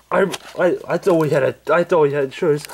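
ordinary delivery

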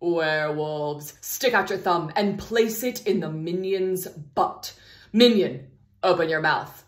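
ordinary delivery

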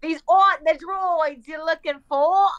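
slow, hammed-up British accent; exaggerated British accent. Echoing friend's film quote, slow exaggerated British accent. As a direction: stretch the line out in broad mock British.

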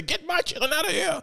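high-pitched imitated cry to get the kids out; retold frantic plea. Officer at the graduation ordering the kids away, high frantic voice. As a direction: squeal it like a panicked lady officer.

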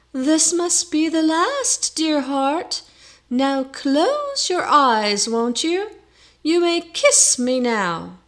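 high, loud, drawn-out sweetheart; calling in put-on voice. A narrator's reading. Nicholas posing as Allison through the shutters, high put-on call. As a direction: call out high and slow as a pretend lover. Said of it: "he called through the shutters".

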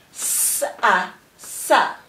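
hissed s, separate a, then "sa" as one syllable; sounding out and blending. Shown as a demonstration. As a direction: break "s-a" into sounds, then join as "sa". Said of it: "blend the syllables together".

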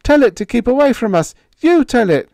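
high, loud and fast; terrified pleading. A narrator's reading. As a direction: beg her in panic. Higgins begging in terror.

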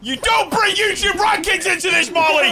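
loud, high jokey indignant shout; mock outrage. Friend scolds Molly over YouTube rankings, mock outraged shout. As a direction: shout it in fake offence.

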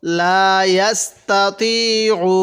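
ইয়াস্তাতিউ slow, with the ain pressed. Shown as a demonstration. Teacher showing how to press the ain, slow. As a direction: lean on the ain of ইয়াস্তাতিউ, slowly. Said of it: "আইনটা একটু চাপ দিয়ে পড়ব".

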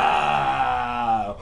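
long, loud hammed-up holler; mock falling yell. Player imitating the thrown crook, drawn-out mock scream. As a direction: yell as if flung through the air. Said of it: "And he goes".

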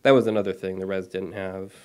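plain reading voice

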